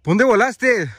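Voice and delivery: grito agudo, muy fuerte, a lo lejos; grito de llamada. Real, not acted. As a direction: lanza la pregunta a gritos. Le grita a la criatura huida. (gritando fuerte hacia lo lejos)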